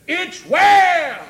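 high, loud proclamation; preaching shout. Real, not acted. Preacher proclaiming faith in trouble, shouted. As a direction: burst out at full voice.

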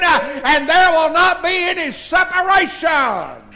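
shouted preaching line. Acted. Pastor crying out the promise of no separation, shouted. Shouted peak before quiet echo.

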